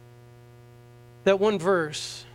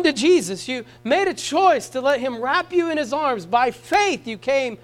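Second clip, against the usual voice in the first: higher, building refrain on "choice"; rising preaching; 6 semitones higher, 4 dB louder than usual. Acted. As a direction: hammer the choice home. Preaching at full force.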